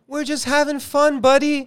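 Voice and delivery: high, falsely chummy tease; mock-sweet teasing. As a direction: coo it at him like a sly pal. Mock message to the director.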